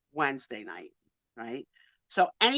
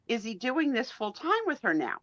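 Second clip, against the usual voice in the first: usual speaking voice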